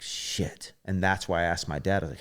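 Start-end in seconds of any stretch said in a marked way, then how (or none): 0.0-0.7 soft unvoiced "Shit"; whispered curse. Finishing the routine unsure, a whispered curse. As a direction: breathe it out in dismay.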